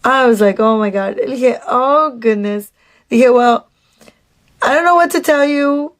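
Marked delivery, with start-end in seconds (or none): none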